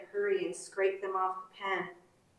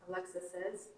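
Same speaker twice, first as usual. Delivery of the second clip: plain reading voice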